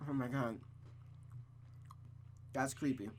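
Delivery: ordinary talk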